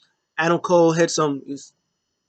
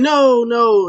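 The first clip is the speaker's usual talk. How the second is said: loud high mock cry of pain; pained plea; 7 semitones higher, 7 dB louder than usual. McAfee stuck in the figure four, loud pained no. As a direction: yell no like trapped in a hold. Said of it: "He's like".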